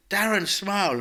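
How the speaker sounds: calling out loud and high across the room; cheery shout to get him to smile. Retold or imitated. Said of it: "I shouted from the side".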